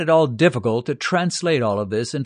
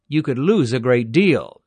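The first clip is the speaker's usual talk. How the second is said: ordinary delivery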